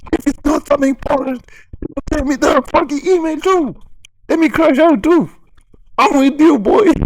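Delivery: high, loud hype yell; loud excited hype, yelling in agreement; hyped shout. Real, not acted. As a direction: crank it up and yell along like a hype man. Hyped rant reaction shouting along.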